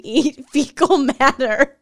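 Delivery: disgusted laugh through the words; grossed-out laughing. Real, not acted. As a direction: laugh out the gross bit slowly.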